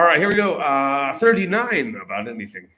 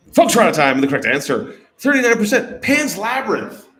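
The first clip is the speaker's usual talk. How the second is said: loud, projected answer reveal; host announcement; 6 semitones higher, 10 dB louder than usual. Real, not acted. Host gives players the correct answer, loud announcement. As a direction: project it to the whole room.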